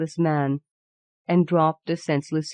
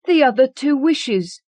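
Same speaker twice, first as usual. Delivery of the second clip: usual speaking voice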